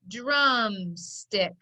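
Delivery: DRUMSTICK stretched out, syllable by syllable; slow blending. Shown as a demonstration. Teacher putting the syllables together, slowly blending DRUMSTICK. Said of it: "Put it together".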